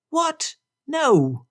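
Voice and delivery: shocked high outburst; exclaimed. Acted. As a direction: blurt out in disbelief. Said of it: "Bingley exclaimed".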